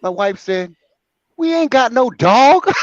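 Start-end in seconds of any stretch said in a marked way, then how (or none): 1.4-2.8 laughing. Retold or imitated. Wife's deadpan reply, laughing.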